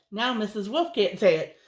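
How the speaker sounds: usual speaking voice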